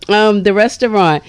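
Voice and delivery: ordinary talk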